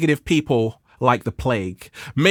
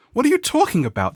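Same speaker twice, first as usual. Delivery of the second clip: surprised; 9 semitones higher, faster than usual. Retold or imitated. Startled reply to a stranger.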